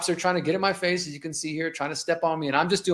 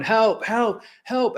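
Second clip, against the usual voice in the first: high-pitched mock cry for help; imitated yell for help; 6 semitones higher, much slower than usual. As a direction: retell a man's yell without really shouting. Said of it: "He's yelling".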